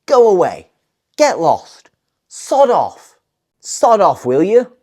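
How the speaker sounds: mock-annoyed dismissal, sharply falling; telling someone off. Shown as a demonstration. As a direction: send them packing, acted. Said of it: "telling someone to get lost".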